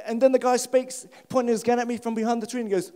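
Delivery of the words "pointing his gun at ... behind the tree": ordinary delivery, nothing marked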